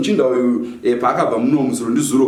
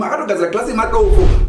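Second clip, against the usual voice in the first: ordinary delivery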